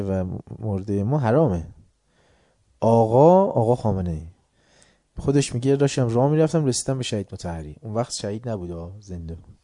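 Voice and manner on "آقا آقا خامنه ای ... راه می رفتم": usual speaking voice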